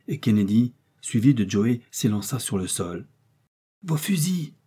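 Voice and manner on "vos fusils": high urgent call; sharp rise into a high exclamation; crying out. A narrator's reading. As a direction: call for weapons in alarm. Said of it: "s'écria le docteur".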